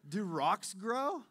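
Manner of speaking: booming slow rhetorical question; loud slow question. Real, not acted. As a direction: land the question big and slow. Preacher's rhetorical punchline question.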